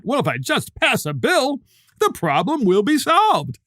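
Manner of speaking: hammed-up innocent believer; mock naive voice. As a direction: play the gullible kid who thinks a bill fixes all. Mocks naive young people.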